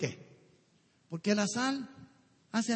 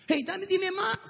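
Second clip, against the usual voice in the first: eager listener; 11 semitones higher than usual. Retold or imitated.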